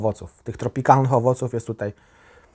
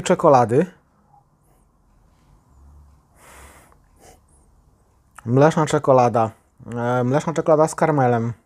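glass just below mouth; talking between sniffs of drink; speaking over a glass. Real, not acted. Seen 0: Small tasting glass held just under lips while speaking.

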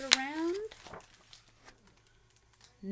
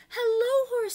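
much higher, much louder greeting; Duck calling hello; 14 semitones higher, 11 dB louder than usual. Acted. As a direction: call out brightly as Duck. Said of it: "says Duck".

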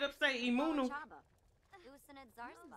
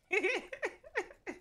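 a burst of high-pitched laughter; delighted high laugh; 10 semitones higher than usual. Real, not acted. Streamer laughs at own clip.

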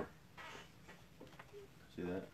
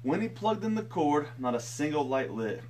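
storytelling. A narrator's reading. Reads picture book aloud.

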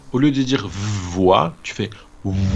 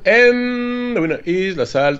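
announcer voice; 9 semitones higher than usual. Pretend. Mock award announcement in game.

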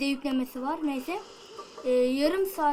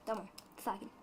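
much softer, telling himself to calm down; calming myself; 4 semitones lower, 15 dB quieter, faster than usual. Real, not acted. Gamer settling himself mid-fight, murmured to self. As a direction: hush it to yourself.

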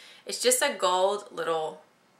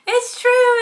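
very high, loud, gleeful it's true; excited reveal; 10 dB louder than usual. Retold or imitated. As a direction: blurt the big news with delight. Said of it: "And I said".

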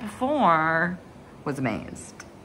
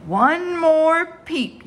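slow, louder, deliberate warning; stern father warning; 5 semitones higher, 5 dB louder, much slower than usual. Acted. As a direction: lay down the law slowly. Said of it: "his father said something that worried Chris".

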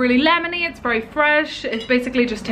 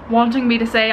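normal talking voice

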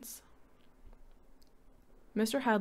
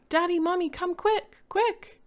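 high, loud urgent call; children calling their parents to come; 11 semitones higher, 8 dB louder than usual. Acted. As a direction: call them from far down the house. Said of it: "heard the children calling".

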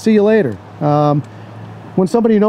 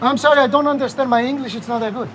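broken English with a Greek accent, playing dumb; fake Greek accent; 2 semitones higher, 5 dB quieter than usual. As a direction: pretend to be a Greek who barely speaks English. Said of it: "I'm gonna bust out the Greek accent".

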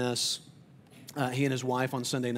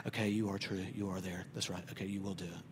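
quiet reassurance; 6 semitones lower, 9 dB quieter than usual. Retold or imitated. Moses reassured, quiet reminders.

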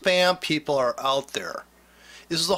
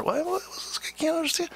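high, baffled squeak of a lost beginner; mock confused trader; 13 semitones higher, 4 dB quieter than usual. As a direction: play the clueless newbie, pitched way up.

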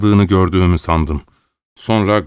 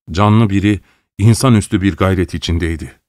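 ordinary delivery

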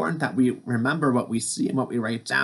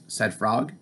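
plain reading voice

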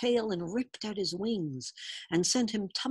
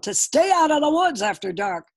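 loud, raised-pitch fatherly scolding; angry father laying down the law; angry growl; 7 semitones higher, 8 dB louder than usual. A narrator's reading. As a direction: growl the warning like a cross father. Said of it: "he growled".

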